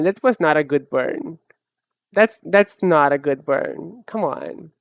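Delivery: ordinary delivery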